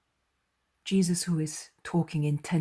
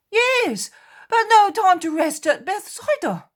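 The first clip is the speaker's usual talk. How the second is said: much higher and louder; agitated apostle; 15 dB louder than usual. Acted. Peter complaining to the others about no rest, higher and louder. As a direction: Peter protesting in a raised voice.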